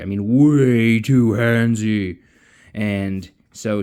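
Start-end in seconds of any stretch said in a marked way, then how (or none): 0.3-2.2 louder, slow, stretched out disgust; drawn-out emphasis. Real, not acted. Narrator stressing a creepy guy's behaviour, slow emphatic. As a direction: lean hard into how gross he was. Aside about a handsy guy.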